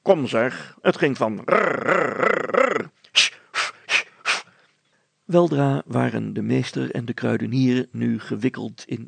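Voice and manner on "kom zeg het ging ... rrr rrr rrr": rolled rrr buzzing noise, rising; imitated whirring rrr. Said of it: "en hij begon weer".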